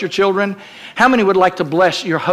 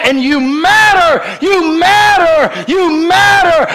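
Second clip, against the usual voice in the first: each you matter climbs higher, louder and slower; building shout; 7 semitones higher, 3 dB louder, slower than usual. Acted. Jesus calling out to an abandoned child, building shout. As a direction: pound it home, rising each time.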